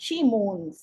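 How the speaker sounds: "She mourns" said apart as a falling model phrase; demonstrated stress pattern. Shown as a demonstration. Teacher modelling "She mourns" for students to practise, demonstrated stress. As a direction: model "She mourns" for repetition, letting it fall. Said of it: "जिस तरह से मैं बोल रही हूँ".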